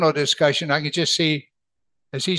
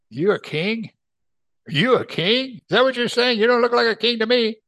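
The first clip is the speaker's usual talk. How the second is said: higher-pitched, doubting questions; incredulous; 7 semitones higher than usual. Acted. Pilate sizes up a haggard prisoner, incredulous. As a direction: question it in mock disbelief.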